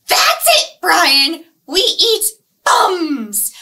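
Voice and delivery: loud, high wailing fish shout; furious piranha. Acted. Piranha losing patience with Brian, furious shout. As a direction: explode at Brian in full fury. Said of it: "my piranhas here".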